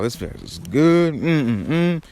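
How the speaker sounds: drawn-out relish, rising into appreciative hums; re-enacted foodie delight; savoring. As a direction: savor it like a delicious bite. Relishing the ceviche in quoted reaction.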